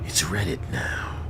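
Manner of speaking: hushed whisper of the line. Real, not acted. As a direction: whisper it to yourself. Echoes own line in whisper.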